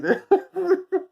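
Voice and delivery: squeaky high laugh at his own joke. Real, not acted. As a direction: let a high giggle escape.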